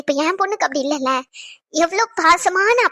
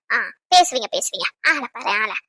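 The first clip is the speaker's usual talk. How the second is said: mocking mutter; 8 semitones lower, 4 dB quieter than usual. Real, not acted. Wife mutters mockingly at husband.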